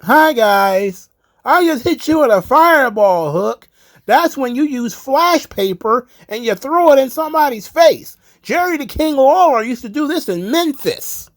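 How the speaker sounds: high, loud mocking impression of a wrestler; Chris Jericho yelling. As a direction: yell like an over-the-top commentary character. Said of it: "Jericho's yelling in my face".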